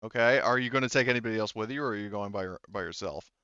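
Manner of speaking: usual speaking voice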